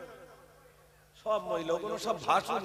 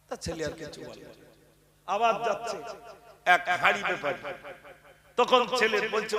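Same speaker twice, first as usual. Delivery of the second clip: ordinary talk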